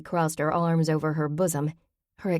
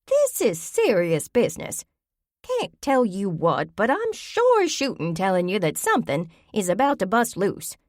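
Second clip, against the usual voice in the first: higher, louder insistent granny; urgent old grandmother; 4 semitones higher, 3 dB louder than usual. Acted. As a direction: warn them like trouble is at the door. Grammy insists, glaring.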